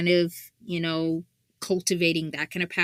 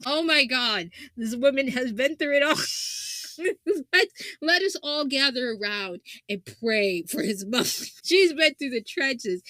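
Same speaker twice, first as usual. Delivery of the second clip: normal talking voice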